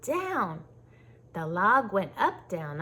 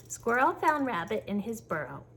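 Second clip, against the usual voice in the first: usual speaking voice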